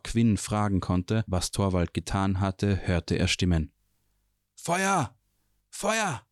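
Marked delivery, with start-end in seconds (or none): 4.5-6.3 high alarmed cry of fire; shouting fire. A narrator's reading. Distant voices raise the alarm, shouting fire. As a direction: call out the alarm, much higher.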